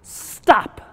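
high, sudden command; heroine's cry to halt; echoing cry. Acted. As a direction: call it out sharply from afar. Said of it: "the word echoed from the forest".

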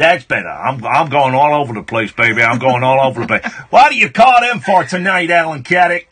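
low mock-American radio host; American accent. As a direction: work the show in a put-on American voice. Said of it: "In an American accent".

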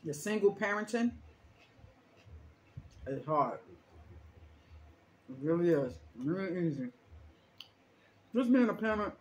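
talking with food in mouth; speaking between bites; chewing while talking. Real, not acted. Seen 2: Bites sandwich at -7, jaw and cheeks chew between words.

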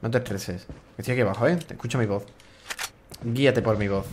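ordinary delivery

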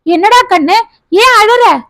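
high, alarmed and pleading; flustered mother. A narrator's reading. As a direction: rush to her crying daughter. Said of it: "பதறிய Valli".

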